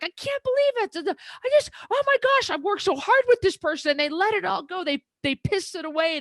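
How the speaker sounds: high-pitched, exasperated outburst, a little rushed at the start; exasperated rant. Retold or imitated. Coach fuming over a client who quit, high exasperated rant. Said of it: "And I get so mad".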